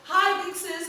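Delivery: high, loud call to big sis; shouted greeting. Retold or imitated. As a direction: call out across the house. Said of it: "I just shouted".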